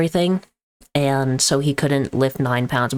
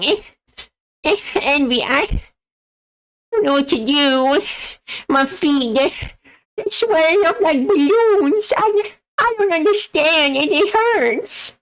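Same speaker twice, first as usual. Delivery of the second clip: grandpa whimpering about swollen feet in a bratty cartoon voice; pitiful whining in a high cartoon-brat voice; Eric Cartman impression; 3 dB louder than usual. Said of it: "I do, like, an Eric Cartman impression".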